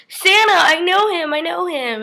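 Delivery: squealed high and loud with wide-eyed joy; Buddy the Elf. Retold or imitated. Vlogger quoting the Elf movie, squealing high excitement. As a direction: gush like a grown man spotting Santa. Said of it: "He goes".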